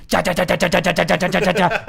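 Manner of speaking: rapid loud repeated stabbing sounds; mimed stabbing sound effect; stabbing noise. Retold or imitated. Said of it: "making, like, a stabbing motion".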